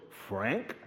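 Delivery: low, soft mock Chris calling Frank; imitating Chris. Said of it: "'Cause she talks like that, weird".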